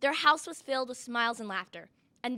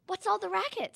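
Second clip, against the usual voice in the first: crying out; 7 semitones higher, 4 dB quieter than usual. A narrator's reading. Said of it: "Cindy cried".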